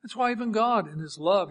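ordinary talk

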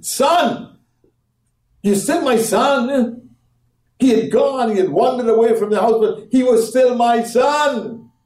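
a father calling his lost son back; loving father. Acted. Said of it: "the father said to him".

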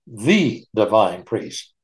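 slow, drawn-out "thee" for "the"; stress on THE. Real, not acted. Teacher repeating the definite article, slow stress on "the". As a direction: set "the" apart as an emphatic "thee". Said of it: "definite article".

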